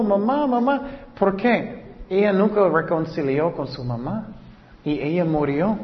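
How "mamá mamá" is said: crying out for mom. Retold or imitated. Girl at her mother's funeral calling Mamá, a high mock wail. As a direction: echo a grieving girl calling Mamá, pitched up but kept mild.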